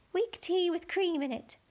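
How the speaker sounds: high, thin little insect voice; a tiny gnat piping up to Alice. A narrator's reading. Gnat telling Alice where to look, high and thin.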